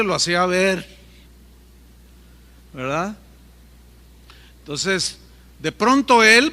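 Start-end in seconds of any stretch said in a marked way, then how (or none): none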